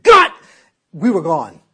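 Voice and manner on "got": "got" as one loud, high burst; emphatic dad. Retold or imitated. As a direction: fire out "got" through clenched teeth. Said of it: "And when he said".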